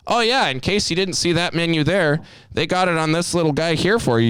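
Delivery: high, exaggeratedly helpful voice; mock-helpful needling. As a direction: imitate a regular pointing out the obvious. Regular needles the customer.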